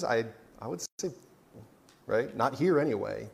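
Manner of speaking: ordinary talk, nothing marked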